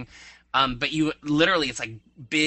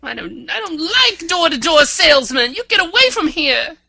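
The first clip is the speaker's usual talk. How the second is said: loud, high mock hillbilly; 11 dB louder than usual. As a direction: bellow like a cranky old farmer. Mock hillbilly threat after doorbell.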